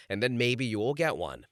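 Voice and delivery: ordinary delivery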